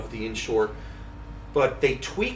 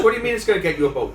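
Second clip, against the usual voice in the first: fast, louder disbelieving question; incredulous wife; 5 semitones higher, 6 dB louder, much faster than usual. Retold or imitated. Wife baffled by the boat idea, fast and louder. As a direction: rattle it off in mock disbelief. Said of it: "She goes".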